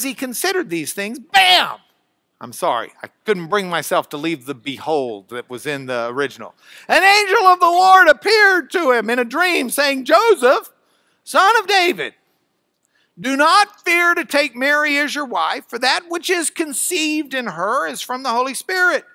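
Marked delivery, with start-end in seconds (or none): 1.2-1.8 loud, high bam; sudden exclamation. Shown as a demonstration. Dramatic interjection in the reading. 6.9-19.0 loud, high scripture reading; dramatic proclamation. A narrator's reading. Dramatic reading of the angel's message.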